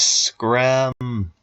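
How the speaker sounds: slow, drawn-out silly voice. Pretend. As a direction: stretch every word out slowly. Silly made-up word, drawn out.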